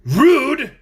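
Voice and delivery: loud, high indignant shout. Acted. Provoked Tin Soldier shouting at the Scarecrow, loud. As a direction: fling the word back, loud. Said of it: "shouted the Tin Soldier".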